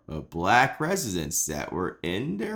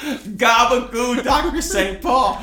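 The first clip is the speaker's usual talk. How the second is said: put-on shouted comic Italian-American accent; 9 semitones higher, 4 dB louder than usual. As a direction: holler it as a hammy Italian mobster. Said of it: "I get to do my Italian accent".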